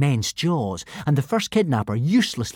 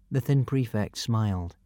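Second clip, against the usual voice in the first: ordinary delivery, nothing marked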